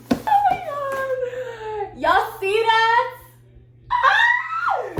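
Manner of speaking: very high, loud, drawn-out squeals; real excited screaming. Real, not acted. Showing viewers the new beat pad, excited screaming. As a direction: shriek at viewers with overwhelmed delight.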